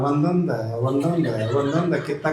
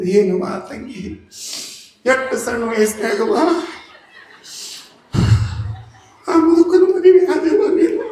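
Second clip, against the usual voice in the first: teasing neighbour; 7 semitones higher than usual. Acted. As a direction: tease her, laughing as you pass on the gossip. Neighbour woman teases young wife. (ನಗುತ್ತಾ ಚುಡಾಯಿಸುವ ನೆರೆಮನೆಯಾಕೆ)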